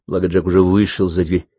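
plain reading voice

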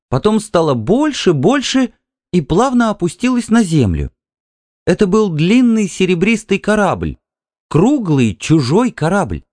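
высоко и медленно, как видение; вспоминать сон с восхищением; мечтательно-восторженно. A narrator's reading. Мечтательное воспоминание сна. (жена с закрытыми глазами вспоминает корабль из сна)

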